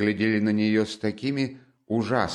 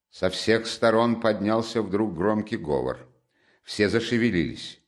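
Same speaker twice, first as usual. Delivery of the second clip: plain reading voice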